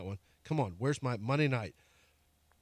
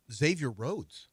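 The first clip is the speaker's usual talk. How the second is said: normal talking voice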